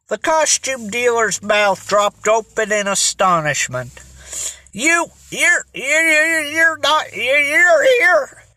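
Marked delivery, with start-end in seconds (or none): 4.7-8.4 high, stuttering disbelief; astonished stammer. A narrator's reading. Costume dealer startled to find the boys at home, stammering incoherently. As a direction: stammer it out high, stuck on each word. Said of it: "he stammered incoherently".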